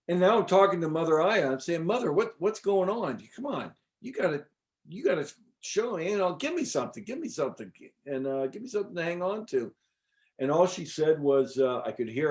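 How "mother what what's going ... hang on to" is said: higher-pitched, impatient coaxing; nagging a higher power, playfully acted; retold pleading. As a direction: plead for any small sign. Acts out begging Mother Aya.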